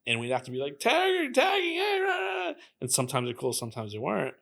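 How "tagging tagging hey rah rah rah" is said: high, drawn-out mock yell; mock gang yelling. Graffiti writer calling out to hostile gangs, high mock yelling. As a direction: imitate a street holler way up high. Acting out yelled confrontations with gangs.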